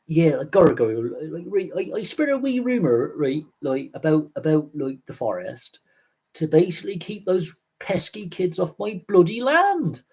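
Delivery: broad West Country yokel, gruff and indignant; hammed-up West Country farmer voice; West Country accent. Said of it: "I've gotta stop doing that".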